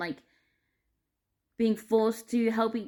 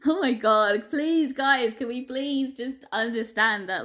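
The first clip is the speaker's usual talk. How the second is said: higher, louder, drawn-out begging after a laugh; desperate, laughing plea; 4 semitones higher, 4 dB louder, slower than usual. Real, not acted. Begging listeners to understand, desperately exasperated. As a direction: plead with them, half laughing in desperation. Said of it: "I'm laughing 'cause I'm, like, so fucking desperate".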